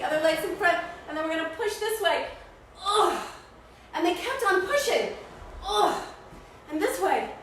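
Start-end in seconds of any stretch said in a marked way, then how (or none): 2.8-3.3 effort grunt. Acted. Pushing the whale. 5.7-6.0 effort grunt. Acted. Pushing the whale.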